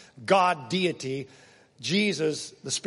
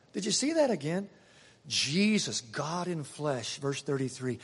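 normal talking voice

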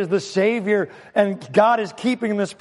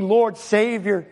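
ordinary talk, nothing marked

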